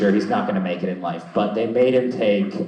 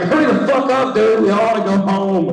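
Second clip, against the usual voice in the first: impatient friend; 13 semitones higher, 6 dB louder than usual. Retold or imitated. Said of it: "He's like".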